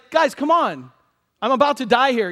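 loud, high-pitched appeal; exasperated plea. Acted. Jesus begs sleepy disciples, exasperated plea. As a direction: plead with them to wake up.

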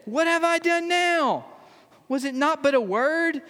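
high, exasperated protest; indignant younger brother. Acted. David protesting to his angry older brother, exasperated. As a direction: throw up your hands, what did I do.

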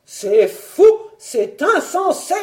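loud outraged yell, climbing high; howling. A narrator's reading. Baron at his wife's demands, howling in outrage. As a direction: bellow it slowly, rising as you go. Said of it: "hurlait le baron".

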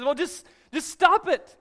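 loud, high, exasperated stop it; retold yell. Counselor yelling at the frightened woman. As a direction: snap it at her, out of patience. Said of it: "And he just screams".

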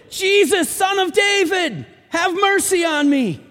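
high, loud desperate cry out; loud pleading shout. Retold or imitated. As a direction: call out like a blind beggar begging to be heard. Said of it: "He shouted".

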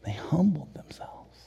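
hushed whisper. Acted. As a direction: breathe it reverently. Hushed repeat of humbled.

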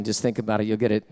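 normal talking voice

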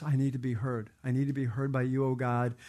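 soft, low pleading prayer; hushed prayer. Acted. David quietly pleading to be heard by God, soft. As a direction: pray it softly, almost to yourself.

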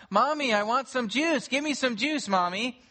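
put-on high pleading child's whine; whiny child. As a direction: beg like a small child wanting juice. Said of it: "He heard the child cry out".

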